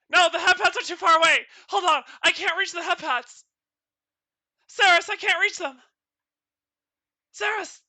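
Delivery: high, loud panicked cries; frantic shouting. Acted. Avatar yelling to Saris that it can't reach the head pats. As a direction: yell for help, reaching and failing.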